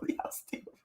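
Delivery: voiceless, very soft words lost in laughing; helpless laughter. Real, not acted. Streamer cracking up at chat, voiceless with laughter. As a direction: squeeze it out with no voice left.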